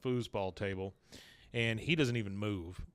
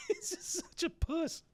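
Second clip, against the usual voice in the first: laughing; 7 dB quieter than usual. Real, not acted. Laughing at Dale's cowardice.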